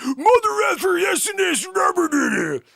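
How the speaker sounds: very high, loud cartoonish yell; mock caller outburst. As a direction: squawk a silly burst of near-swearing. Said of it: "you're like".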